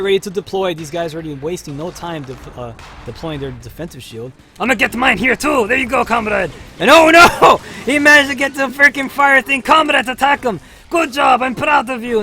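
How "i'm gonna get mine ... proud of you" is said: much higher and louder, cheering the team on; excited shouting. Real, not acted. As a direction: yell encouragement mid-battle. Frantic fight, cheering teammates.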